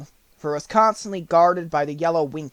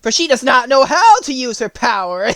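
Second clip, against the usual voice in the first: slow, then rising high and loud, a wicked witch plotting; witch's scheming thought; 4 semitones higher, 6 dB louder, slower than usual. A narrator's reading. Witch plotting to enslave Dorothy, sly wicked glee. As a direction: savour the plan like a sly old witch. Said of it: "laughed to herself and thought".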